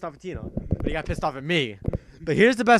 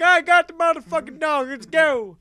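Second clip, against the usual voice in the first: high, loud, hyped-up buddy yelling; bursting in shouting the news; excited buddy; 12 semitones higher, 8 dB louder than usual. Retold or imitated. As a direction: friend back with the dog, shouting high and hyped. Friend Brock quoted bursting in.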